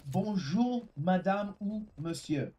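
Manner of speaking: "Bonjour, madame ou monsieur" said slowly in careful French; French greeting. Shown as a demonstration. Teaching the right greeting, slow and clear French. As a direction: demo the polite French hello, slow and deliberate. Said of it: "It's saying".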